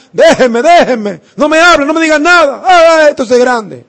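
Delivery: high, loud, desperate wailing plea; wailing refusal. Retold or imitated. Preacher voicing a sobbing woman, wailing high and loud. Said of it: "se fajaba a llorar".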